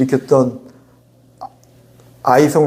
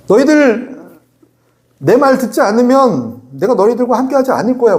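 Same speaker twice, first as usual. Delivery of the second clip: high, raised scolding threat; stern warning; 8 semitones higher than usual. Acted. God warning His people to listen, stern mock threat. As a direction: warn them like a parent laying down the law.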